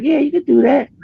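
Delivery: high-pitched imitation of an opponent; high, keen retold reply; eager agreement. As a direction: pitch it way up, mock-encouraging.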